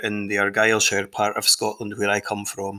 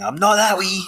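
dog's voice; 10 semitones higher, 5 dB louder than usual. Retold or imitated. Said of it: "He's looking at you right now going".

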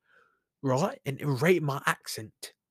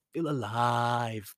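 "feel alive" sunk lower and stretched out; low, slow echo; 3 semitones lower, much slower than usual. Real, not acted. Speaker letting the point of living sink in, low and slow. Motivational refrain, repeated lower, slower.

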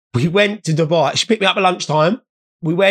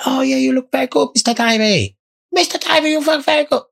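put-on high, sing-song Chinese-accented salesman; imitated Chinese shopkeeper accent; 8 semitones higher than usual. Comic doing a Chinese shop seller's accent to the crowd. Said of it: "It's not racist doing the voice".

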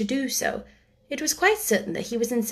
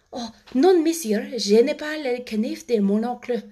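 schoolgirl reciting French; 3 semitones higher than usual. Acted. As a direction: switch abruptly to reciting aloud in French. Recites French exercise in class.

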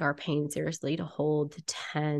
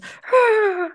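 high, loud wordless noise; silly face-pulling noise; 8 dB louder than usual. Shown as a demonstration. Demonstrates silly face-making noise.

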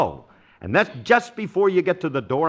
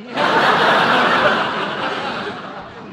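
breathy laugh; 8 semitones higher than usual. Real, not acted. [name] laughs at Frankie's reply.

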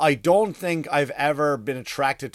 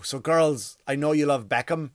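ordinary delivery

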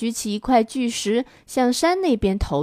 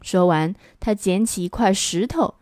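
usual speaking voice

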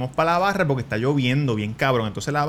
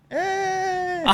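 sudden very high softer startled eh; startled high yelp; 5 dB quieter than usual. Retold or imitated. He spins around at the airport and yelps, very high startled eh. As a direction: jump as if caught.

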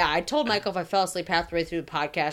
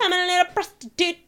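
high, louder playful voice; high silly voice; 12 semitones higher, 5 dB louder than usual. Pretend. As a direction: squeak it out playfully.

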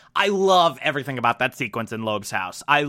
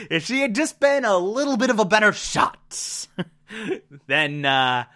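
pirate voice; 4 semitones higher than usual. Pretend. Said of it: "I don't know why [name]'s a pirate now".

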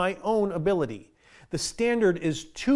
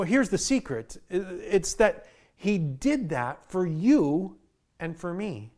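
usual speaking voice

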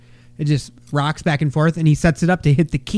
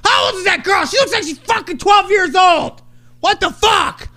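yelling at coaches; 14 semitones higher than usual. Retold or imitated. Dad yelling at the coaches about the girl's age, outraged. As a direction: rant at the other coaches. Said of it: "I wanted to go yell at the coaches".

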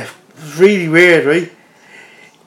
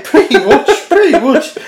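amused; 9 semitones higher, 3 dB louder, slower than usual. Real, not acted. Amused agreement to crude joke.

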